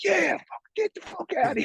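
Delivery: very high, strained mock yell; imitated cheering crowd. Whole line jeering at the man, high mock cheer. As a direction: egg them on like a rowdy onlooker.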